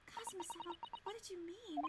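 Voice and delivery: high, very soft voice; wondering assistant character. Acted. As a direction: ask it softly, as if to someone absent. Assistant wonders about absent friend.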